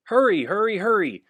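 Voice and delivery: loud, high urgent call; urgent shout. Acted. Firemen rushing out, urgent shout. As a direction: push everyone to move fast.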